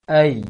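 "Ai" loud, a gliding up to i; ya sukun glide. Shown as a demonstration. Teacher demonstrating ya sukun glide, loud. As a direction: raise the tongue after the a. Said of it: "naikkan tengah lisannya ke langit".

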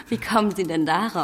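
ordinary talk, nothing marked

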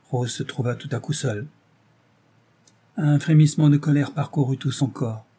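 ordinary delivery

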